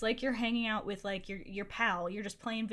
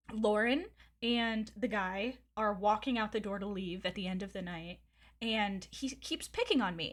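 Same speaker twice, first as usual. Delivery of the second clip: ordinary talk, nothing marked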